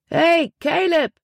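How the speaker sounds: high, drawn-out hail; calling out. A narrator's reading. Boy behind the crowd hailing his friend, calling out high and slow. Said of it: "rief er".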